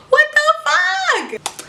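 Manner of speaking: high, slow what the fuck; drawn-out, high-pitched disbelief; outraged disbelief. Real, not acted. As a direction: stretch it out in shock.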